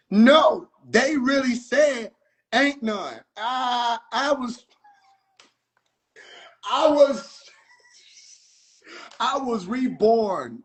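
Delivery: ordinary talk, nothing marked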